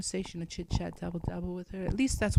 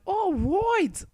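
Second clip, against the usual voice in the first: mock cheer; 11 semitones higher than usual. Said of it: "I was like".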